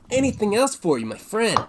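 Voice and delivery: high, loud, beaming innkeeper voice; cheerful bartender. Acted. Bartender waving the adventurers in, high and loud. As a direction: greet the party like an eager barkeep.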